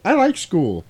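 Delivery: high-pitched kid imitation; mock boy's voice. Wheelchair boy answering his schoolmates, high mock child voice. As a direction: play an earnest schoolboy.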